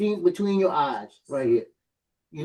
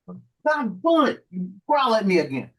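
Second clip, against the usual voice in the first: drawn-out, higher exasperated curse; exasperated outburst; 4 semitones higher, slower than usual. Real, not acted. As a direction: let it out slowly, fed up with the dog. Dog growls at him again.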